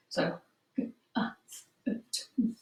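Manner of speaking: g, a, v, o, d as pure separate sounds; sounding out words. Shown as a demonstration. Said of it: "Segment and blend it back together".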